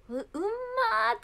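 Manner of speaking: high, loud, drawn-out yum; papa-style exclamation. Retold or imitated. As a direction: blurt it out in casual delight, stretching the vowel. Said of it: "そういう喋り方なパパのせいだって". (父親譲りの豪快な「うんまー」)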